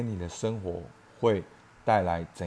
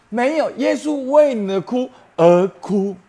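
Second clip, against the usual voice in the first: high, loud impassioned preaching cry; 激昂高呼; 13 semitones higher, 9 dB louder than usual. Real, not acted. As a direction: lift it into a pleading, passionate climax. Preacher rising to passionate climax. (高声激昂地讲道)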